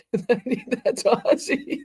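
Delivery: high, slow words dissolving into a giggle; can barely get it out for laughing. Real, not acted. Streamer spots [name] lookalike again, cracking up.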